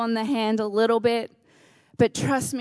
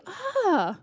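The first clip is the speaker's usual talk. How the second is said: mock delighted ah; 7 semitones higher, 4 dB quieter than usual. Mock realization.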